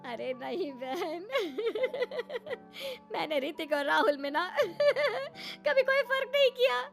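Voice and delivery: nonstop laughing. Acted. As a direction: protest broken by high cackles. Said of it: "कुसुम तो सिर्फ हंसे ही जा रही थी".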